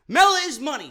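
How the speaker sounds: mock catchphrase, pitched high and loud; zitierter Ausruf. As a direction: mimic her catchphrase, high and punchy. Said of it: "she says".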